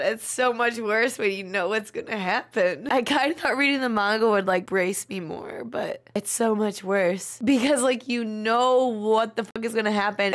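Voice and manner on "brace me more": usual speaking voice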